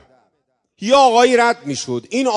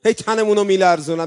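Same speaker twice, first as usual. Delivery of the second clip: normal talking voice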